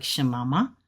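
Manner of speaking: "Shemama" lifted at the end like a question; question tune. Shown as a demonstration. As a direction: quote "Shemama" as a rising question. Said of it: "You might hear that in a movie".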